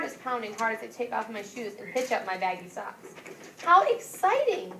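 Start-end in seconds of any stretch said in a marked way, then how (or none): 3.6-4.7 high, loud, slow delight; excited grandma. Acted. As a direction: stretch it out, thrilled. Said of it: "Grandma says".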